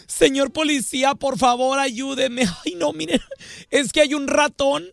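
high, hammed-up begging voice; mock effeminate pleading. Young man begging the policeman to help, high mock pleading. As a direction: plead with the cop in a fluttery high voice.

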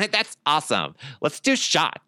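high put-on female voice, laughing; imitating a woman. Imitating the ladies who love the word, high girly voice. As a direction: squeal it like an excited party girl. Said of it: "Is he doing an impression of me".

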